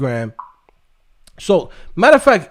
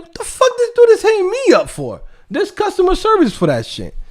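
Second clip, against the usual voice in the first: much higher put-on voice, baffled and annoyed; Jeff Bezos annoyed; 13 semitones higher than usual. Billionaire mid-interview mocking a fan's email, high annoyed voice. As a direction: play the billionaire baffled by a pestering fan. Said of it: "Jeff Bezos looks around like".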